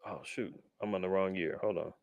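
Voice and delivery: ordinary delivery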